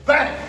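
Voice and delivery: loud, high, sudden "bang"; impact sound. Shown as a demonstration. Teacher shows attacker shooting for legs, explosive bang. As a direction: crack out a bang for the hit.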